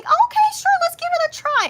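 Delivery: agreeing to the friend's drama tip, eager; eager agreement. Retold or imitated. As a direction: jump at the offer.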